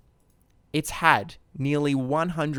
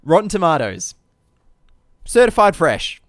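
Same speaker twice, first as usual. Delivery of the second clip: higher and louder, proud fanfare; loud announcement; 3 semitones higher, 7 dB louder than usual. Acted. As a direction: announce it like a big reveal. Announcing the critic score.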